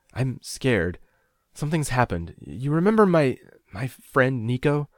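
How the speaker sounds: high, faltering fearful confession; voice breaking. A narrator's reading. Frightened caller telling mother about friend, breaking up. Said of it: "voice breaking".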